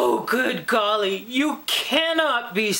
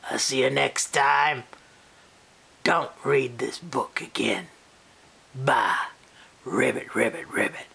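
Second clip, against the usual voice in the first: frog voice; 11 semitones lower than usual. Acted. Speaks on as a frog.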